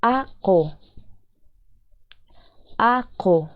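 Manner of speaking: "aco" in two separated syllables, a... co; sounded-out word. Shown as a demonstration. Teacher giving learners the example word, sounded out. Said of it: "la palabra".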